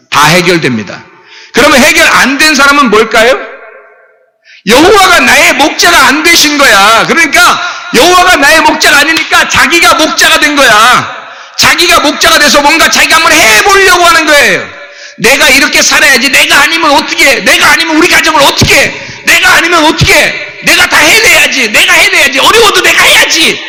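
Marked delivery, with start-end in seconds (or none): 1.5-14.7 high, driving sermon delivery; impassioned preaching. Real, not acted. Preaches at full force. 15.2-23.6 high, urgent acted self-talk; self-driven insistence. Voicing someone who shepherds himself.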